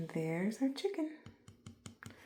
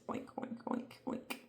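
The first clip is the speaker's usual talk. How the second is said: soft breathy oinks; pig oinks; 7 dB quieter than usual. Shown as a demonstration. Teacher whispering the pig's oinks. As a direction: oink quietly like a pig. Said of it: "This pig says".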